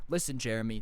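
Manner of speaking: low, stern parent imitation; mock stern parent. Parent answering little Jeremy about the bike, mock stern. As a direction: drop the pitch to take a child to task.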